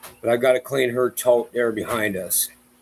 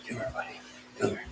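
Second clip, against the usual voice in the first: whispering; 12 semitones higher, 14 dB quieter, faster than usual. Real, not acted. Coaxing the dog closer.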